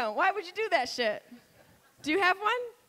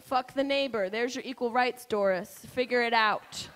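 normal talking voice

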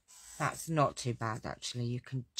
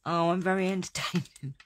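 higher, louder sarcastic boast; mock self-praise; 5 semitones higher, 7 dB louder than usual. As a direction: preen ironically at the chat. Sarcastic reply to viewer comment.

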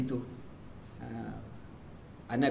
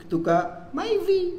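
mock dismay; much slower than usual. Mock contrast Audi to Myvi.

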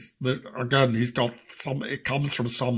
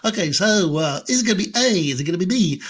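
higher, louder quiz-host voice; Chris Tarrant impression; 5 semitones higher, 4 dB louder than usual. Quiz host teasing a contestant, higher and louder. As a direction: tease the contestant with the options. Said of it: "Chris Tarrant has".

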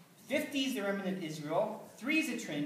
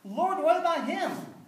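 much higher and louder, a sudden jealous cry; jealous outburst; 9 semitones higher, 8 dB louder than usual. Acted. Peter to Jesus on the beach, jealous of John, loud high cry. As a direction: Peter blurts it out, stung and envious. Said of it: "He asks in a fit of jealousy".

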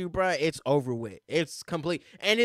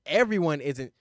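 ordinary talk, nothing marked

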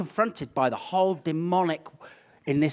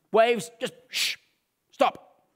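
higher, hushing command; commanding Jesus; 7 semitones higher, 3 dB louder, slower than usual. Retold or imitated. Jesus hushing a storm at sea, commanding. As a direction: order the waves to be still. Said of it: "gets up, says".

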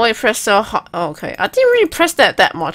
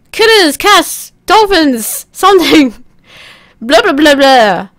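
loud nonsense shouting; 7 semitones higher, 8 dB louder than usual. Pretend. Player filling the game with noise, high shouted nonsense. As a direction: holler out whatever word comes to mind.

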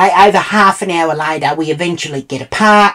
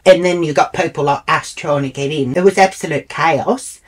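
ordinary talk, nothing marked

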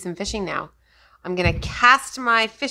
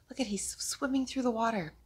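hushed teacher whisper; 2 semitones higher, 8 dB quieter than usual. Shown as a demonstration. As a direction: whisper it so the children lean in. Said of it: "unvoiced whisper".

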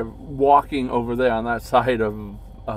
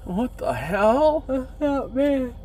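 startled; 11 semitones higher, 4 dB louder, slower than usual. Real, not acted. Startled by noise in woods.

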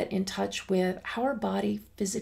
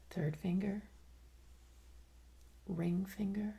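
guided meditation; 7 dB quieter than usual. Acted. Guiding listeners through a body scan, soft guided meditation. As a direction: guide them gently through the body, unhurried and hushed.

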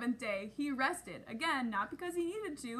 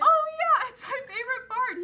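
much higher, louder burst of glee; excited puppet; 11 semitones higher, 12 dB louder than usual. Acted. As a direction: squeal it out like a thrilled kid puppet. Puppet excited about favorite part.

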